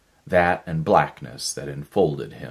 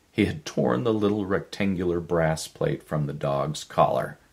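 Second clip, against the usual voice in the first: usual speaking voice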